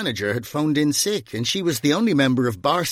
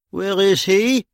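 gruff demand; 9 semitones higher, 4 dB louder, slower than usual. Acted. Bunny bursts in looking for him.

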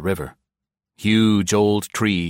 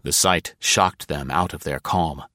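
usual speaking voice

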